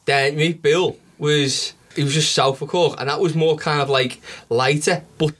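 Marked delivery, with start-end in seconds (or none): none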